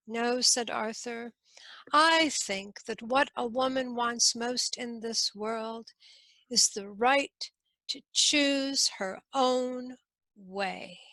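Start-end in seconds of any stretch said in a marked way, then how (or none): none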